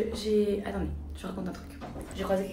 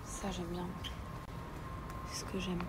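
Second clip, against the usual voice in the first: à voix basse; 11 dB quieter than usual. Real, not acted. Commente tout bas en boutique.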